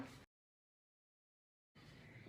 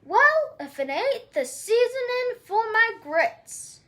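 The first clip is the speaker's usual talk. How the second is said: higher, loud mocking shout; giant shouting; 4 semitones higher, 4 dB louder than usual. Giant spotting the cowgirl, gloating shout. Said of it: "the giant shouted".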